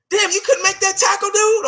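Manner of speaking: high-pitched exasperated heckle; mock reproach. Acted reproach to players.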